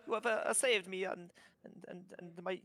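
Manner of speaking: lightly voiced kobold, a touch sad over the horse; fussy little trader lamenting his loss; mildly grieving kobold merchant. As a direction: kobold with a hint of Italian lilt, mildly sorrowful.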